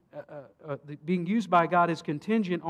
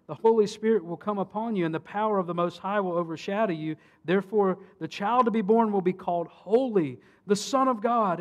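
proclaiming angel; 5 semitones higher, 7 dB louder than usual. Acted.

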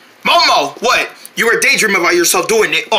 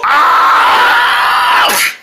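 breathy falling scream; 7 semitones higher, 4 dB louder than usual. Acted. Falling into the heater.

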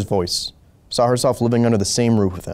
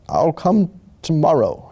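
slow, higher-pitched reluctant reply; muttered; 7 semitones higher, slower than usual. A narrator's reading. Sleepy doctor putting off the wife, slow muttered reply. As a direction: put him off slowly, as if muttering. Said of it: "he muttered".

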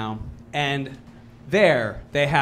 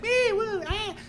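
high, quiet little yelps; toy-sized screams of fun; playful mock scare yelps; 4 dB quieter than usual. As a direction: squeal lightly, as if enjoying a scare. Imitates reacting to scare actors.

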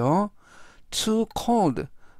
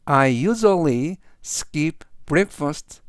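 very slow, careful English reading; slow model sentence; much slower than usual. Shown as a demonstration. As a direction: spell out each word slowly for students. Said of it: "전체적 의미는". (아주 천천히 또박또박 읽는 예문)

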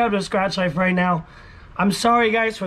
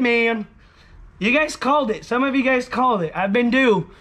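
ordinary talk

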